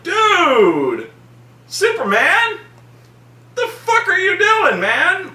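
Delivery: retelling his reaction to Superman, high and laughing; incredulous. Retold or imitated. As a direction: cry out in laughing disbelief. His own retold reaction.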